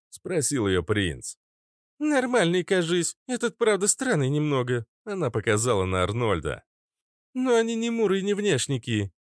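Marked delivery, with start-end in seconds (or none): 2.0-4.9 much higher-pitched character voice; Фитилёк's character voice. Acted. Narrator voices Фитилёк answering. 7.3-9.1 much higher-pitched character voice; Фитилёк's character voice. Acted. Narrator voices Фитилёк vouching.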